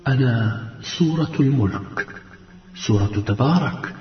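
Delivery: soft, low, serene voice of light; even greater calm. A narrator's reading. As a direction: introduce yourself softly, with deep calm. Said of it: "قال الضوء بهدوء أكبر". (بهدوء أكبر وصوت خفيض)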